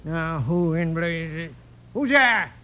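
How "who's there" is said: startled call. Acted. Startled by a knock.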